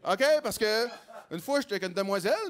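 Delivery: ordinary delivery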